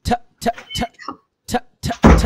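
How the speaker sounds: ঠ as short unvoiced puffs of air; demonstrating ঠ. Shown as a demonstration. Teacher reads out the sound for students to copy, demonstrating aspirated ঠ. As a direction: puff out ঠ three times, aspirated, hardly voiced. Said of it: "আমি পড়ে শোনাচ্ছি".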